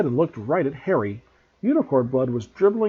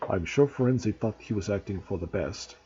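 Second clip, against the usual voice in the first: lower, softer gloomy murmur; 5 semitones lower, 4 dB quieter than usual. Acted. Ronan soothing the angry centaurs, gloomily. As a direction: sound resigned and heavy. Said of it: "in his gloomy voice".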